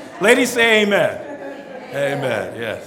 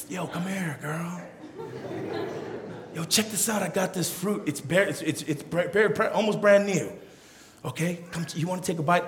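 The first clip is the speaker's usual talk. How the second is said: a sly tempter calling the woman over; smooth-talking snake; 9 dB quieter than usual. Retold or imitated.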